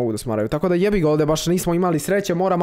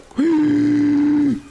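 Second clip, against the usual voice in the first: sharp, high startled gasp; 11 semitones higher, 4 dB louder than usual. Real, not acted. Streamer reacting to a wild outplay, gasping. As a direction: gasp in real shock at the play.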